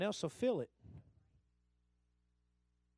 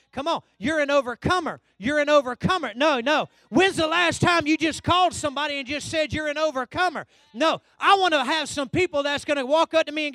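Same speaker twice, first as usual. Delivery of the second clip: insistent, building pulpit delivery; rousing preaching; 7 semitones higher, 13 dB louder than usual. Real, not acted. Preacher urging the congregation that they are overcomers, rousing and insistent. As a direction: preach it louder with each repeat.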